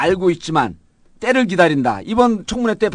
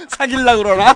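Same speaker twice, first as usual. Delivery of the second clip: teasing through laughter; laughing; 8 semitones higher than usual. Real, not acted. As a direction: laugh while joking. Joking, laughing.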